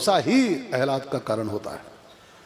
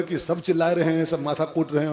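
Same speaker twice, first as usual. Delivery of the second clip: ordinary delivery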